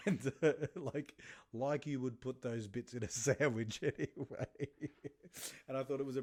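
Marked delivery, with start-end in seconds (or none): none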